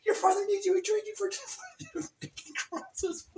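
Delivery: high, fast nagging voice; hammed-up scolding of a lazy son; nagging parent. Parent scolding Jesh to help with crosses, high nag.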